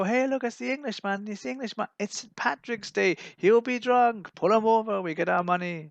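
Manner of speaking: high, loud jeering cop voice; mocking police voice. Police spotting the Englishman, high jeering voice. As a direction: jeer at him like a greedy policeman. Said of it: "They go".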